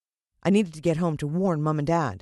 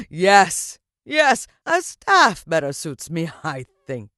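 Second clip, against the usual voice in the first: louder, slow, triumphant villain proclamation; grand villain address; 9 dB louder, slower than usual. Acted. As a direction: spread your arms and own the crowd. Villain voice held.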